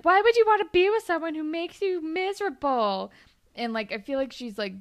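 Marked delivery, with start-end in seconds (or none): 0.0-3.1 mock-flirty and pushy, higher and louder. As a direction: lean in and needle him, half-teasing.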